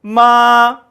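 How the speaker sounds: 마 held level and high; 마 sustained flat on sol, no rise or fall; first tone. Shown as a demonstration. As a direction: hold 마 on one even high note. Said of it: "다시 일성부터 빨리 하면".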